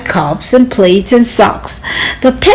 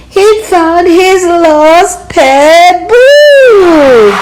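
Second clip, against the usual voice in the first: loud, high, slow triumph; triumphant; 8 semitones higher, 5 dB louder, much slower than usual. A narrator's reading. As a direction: draw it out in triumph. Triumphant discovery.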